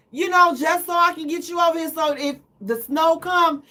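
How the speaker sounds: ordinary delivery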